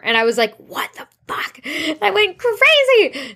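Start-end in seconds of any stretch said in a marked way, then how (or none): 0.6-1.6 breathless whispered disbelief; stunned whisper. Retold or imitated. Host reacting to a famous author saying yes, whispered shock. 1.7-3.3 much higher and louder, drawn out slowly; thrilled squeal. Real, not acted. Host telling listeners about her big guest, thrilled squeal.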